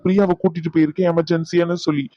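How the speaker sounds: normal talking voice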